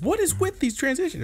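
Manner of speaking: high falsetto, mock baffled question; mock bewilderment. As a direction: squeak out a put-on complaint. Acting out someone's baffled complaint.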